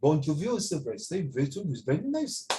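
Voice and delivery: put-on a light, teasing French accent with a sprinkling of French words; gently hammed-up French accent, slowing down at the end; mock French accent. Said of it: "That's how they talk".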